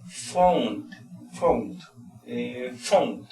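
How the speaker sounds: found, with the N and T sounded; found ending in NT. Shown as a demonstration. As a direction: say found, closing on N then T. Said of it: "tenemos N T".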